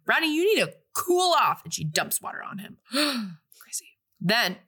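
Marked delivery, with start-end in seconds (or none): 0.1-1.6 higher and louder, telling him off; mock scolding. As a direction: snap at Rodney to calm down. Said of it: "she goes".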